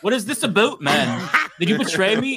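light Canadian accent in a mock confrontation. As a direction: play a Canadian confronting a buddy, mock-accusing. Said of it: "when they say".

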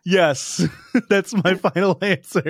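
final answer through laughter; laughing. Real, not acted. Contestant giving his final answer while laughing. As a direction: still giggling as he commits.

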